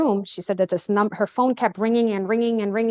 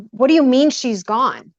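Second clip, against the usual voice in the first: louder, incredulous retold question; retold shocked outburst; 3 semitones higher, 7 dB louder than usual. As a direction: throw the question back in disbelief. Retold shocked challenge to relatives.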